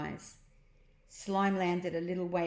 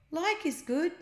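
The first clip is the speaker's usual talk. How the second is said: exclaiming; 11 semitones higher than usual. A narrator's reading. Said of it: "exclaimed Ned".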